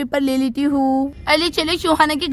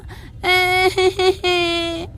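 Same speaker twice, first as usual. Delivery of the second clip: put-on a little boy bawling over his toy; drawn-out child's cry; toddler wail; 2 semitones higher than usual. Toddler bursts into crying.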